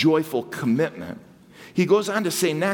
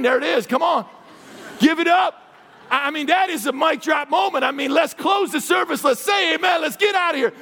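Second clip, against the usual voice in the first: loud, high, fired-up shouting; hyped shout; 9 semitones higher, 3 dB louder than usual. Real, not acted. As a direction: whip the congregation into cheering. Pastor hypes the crowd.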